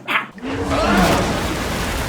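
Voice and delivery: high breathy falling yelp; mock falling scream.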